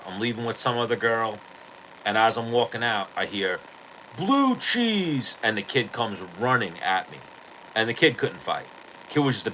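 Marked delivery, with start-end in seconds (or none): 4.2-5.4 much higher yelled battle cry; mock scream. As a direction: holler it high like a drunk kid charging. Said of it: "kid screamed".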